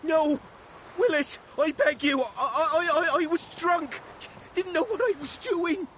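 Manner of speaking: high, panicked begging; desperate pleading. Acted. Arsonist begs the blacksmith.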